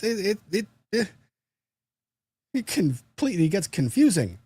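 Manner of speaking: high, flustered helpless voice. Pretend. A lawyer confused by buttons and switches, flustered and high. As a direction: play the bumbling lawyer lost with buttons.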